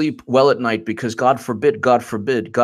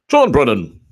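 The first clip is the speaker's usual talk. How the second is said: louder put-on impression voice; John Brennan; 2 semitones higher, 6 dB louder than usual. Host launching his impression of an ex-official, caricatured. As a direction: announce the name in a loud caricature. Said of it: "And I'll be John Brennan".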